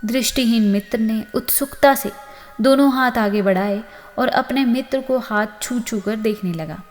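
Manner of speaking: plain reading voice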